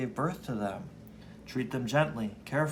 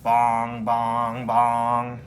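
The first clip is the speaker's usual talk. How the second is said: clock chime imitation; 2 semitones lower, 7 dB louder, much slower than usual.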